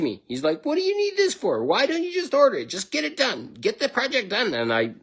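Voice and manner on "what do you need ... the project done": mocking a petulant young manager, pitched way up; whiny little boss. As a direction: sneer it like a bratty kid in charge. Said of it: "a little pissant little boy".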